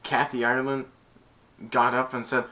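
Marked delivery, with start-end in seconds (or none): none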